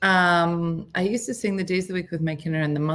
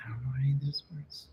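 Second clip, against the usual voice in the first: quick whisper under her breath; whispered aside; 4 semitones lower, 9 dB quieter, much faster than usual. Real, not acted. Talking to herself about the song titles, in a quick whisper. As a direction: mutter it to yourself, fast.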